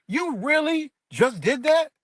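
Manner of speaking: high, slow incredulous question; confronting his ex after she called police; slow disbelief. Retold or imitated. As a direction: let the shock stretch each word.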